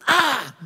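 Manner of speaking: high loud "Ah!" burst; mock holy-spirit yelp; sudden cry. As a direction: let out a sharp high cry. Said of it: "He just goes".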